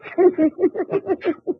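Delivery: high scornful cackle; mocking laugh. Mills laughs at Dietrich's old trick, scornful cackle. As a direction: laugh off the bluff. Gunman mocks the bluff.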